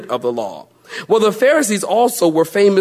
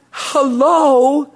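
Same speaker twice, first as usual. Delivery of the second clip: ordinary delivery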